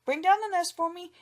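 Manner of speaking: high, loud desperate shout. A narrator's reading. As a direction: yell up the tree in panic. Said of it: "he began to shout".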